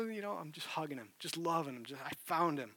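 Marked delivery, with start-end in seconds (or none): none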